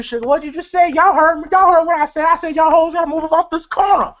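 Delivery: high-pitched mock outrage; indignant retelling. Confronting someone over the corner, high mock indignation. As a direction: act out a street quarrel, pitch up.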